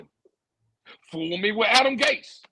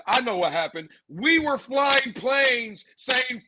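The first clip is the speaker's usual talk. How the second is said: normal talking voice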